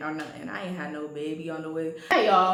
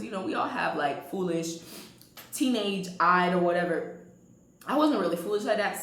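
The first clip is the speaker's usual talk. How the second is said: ordinary talk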